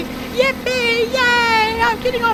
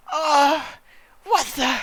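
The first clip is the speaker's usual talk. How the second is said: normal talking voice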